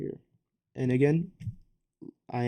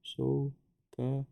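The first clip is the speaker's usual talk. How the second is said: "sokatoa" very slowly, split into so, ka, toa; syllable by syllable; 4 dB quieter, much slower than usual. Shown as a demonstration. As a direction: pause between the parts of "sokatoa". Breaks memory word into syllables.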